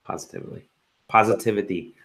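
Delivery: slurring tipsy words. Real, not acted. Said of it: "I'm getting drunk".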